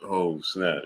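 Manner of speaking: usual speaking voice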